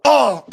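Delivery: loud, high "oh" imitating him; imitated exclamation. Showing what he did, an imitated outburst. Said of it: "This is what he did".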